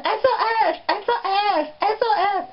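high, shrill-pitched mock distress calls; comic high SOS calls; mock panicked cry. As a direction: cry for help in a hammed-up panic. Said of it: "you're gonna".

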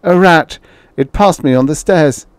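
put-on frightened old man, pitched high and loud; shaking tones. A narrator's reading. Old man explaining the noise away to his wife, shaking tones. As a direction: insist anxiously, high and loud. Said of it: "said the old man in shaking tones".

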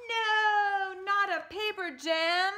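high, loud anguished cry; printer's anguished cry. Acted. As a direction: cry out in dismay. Printer cries out.

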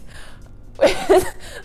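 airy giggle; a quick unvoiced laugh at the phrase; amused breathy giggle. Real, not acted. Laughs at the term.

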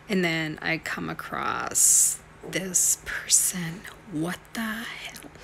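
hushed, slow whisper. Real, not acted. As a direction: whisper it as if someone might hear. Whispering while watching a clip.

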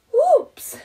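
high, loud yelp of alarm; startled cry of oops; alarmed exclamation. A narrator's reading. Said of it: "exclaimed Rufus".

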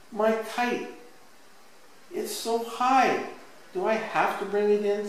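put-on high, reluctant little-boy plea; protesting child. A narrator's reading. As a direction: object like a kid not ready to leave. Jimmy protests about his kite.

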